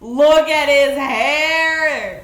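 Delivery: loud, drawn-out fangirl wail; gushing squeal. Real, not acted. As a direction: cry it out in high admiration. Gushing over character's beautiful hair.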